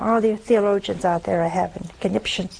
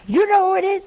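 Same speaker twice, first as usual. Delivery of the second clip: surprised wife; 9 semitones higher, 4 dB louder, faster than usual. Retold or imitated.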